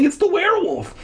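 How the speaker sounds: high, panicked alarm; frightened cry. Acted. Cassie warning friends about the hermit, high and terrified. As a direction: cry it out in terror. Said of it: "Cassie cried".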